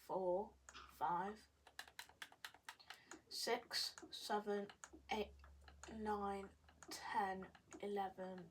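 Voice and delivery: quiet murmured counting; counting under breath. Real, not acted. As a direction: count softly to yourself.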